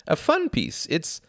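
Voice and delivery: light and delighted; happy. A narrator's reading. Klaus chattering happily to Tom, high and cheerful. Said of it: "said Klaus happily".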